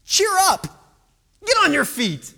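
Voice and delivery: high, loud hearty call. Acted. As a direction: call across to rouse him. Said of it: "they called to the blind man".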